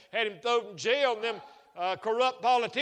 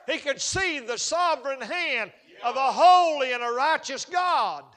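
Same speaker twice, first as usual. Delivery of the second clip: loud, higher, impassioned sermon voice; full-force preaching; 4 semitones higher, 6 dB louder than usual. Acted. Preacher exhorting congregation about Joseph, loud impassioned preaching. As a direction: preach it hard to the back pew.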